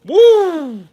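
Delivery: very high whoop of joy; celebratory woo. Real, not acted. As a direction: let out a victory whoop. Celebrates again.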